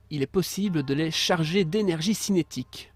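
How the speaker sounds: slow, grand announcer read; dramatic reading. Acted. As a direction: announce it like a game trailer. Reads power description aloud.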